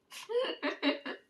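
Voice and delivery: a sudden high-pitched burst of laughter; high squealing laugh. Real, not acted. Laughing hard at a brother's snarky retort, high squealing laugh.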